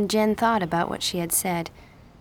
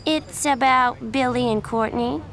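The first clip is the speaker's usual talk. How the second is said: higher, louder fake sympathy; mock concern; 5 semitones higher, 4 dB louder than usual. A narrator's reading. Miranda taunts Jen about rumors, sugary and loud. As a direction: needle her with sugary concern.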